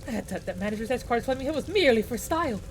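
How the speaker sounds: high-pitched, theatrical distress; old-fashioned hero panicking over the heat; flustered archaic knight. Acted.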